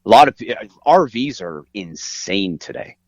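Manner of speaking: ordinary talk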